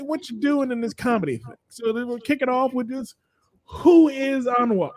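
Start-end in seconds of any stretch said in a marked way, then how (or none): 3.7-4.9 dramatic host question. Acted.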